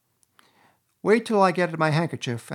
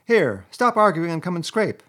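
high, loud, impatient command; exasperated rat, pitched up; exasperated order; 6 semitones higher, 7 dB louder than usual. A narrator's reading. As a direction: order a friend to get to work. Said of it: "cried the rat in despair".

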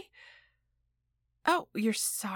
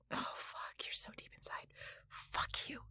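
breathless whisper; 19 dB quieter than usual. Acted. Whispering while husband on phone.

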